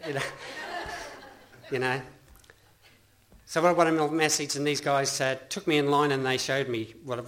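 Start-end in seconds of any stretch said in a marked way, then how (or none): 0.2-1.3 high laugh. Real, not acted. Laughs at own story.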